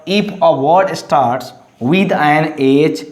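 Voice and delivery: ordinary talk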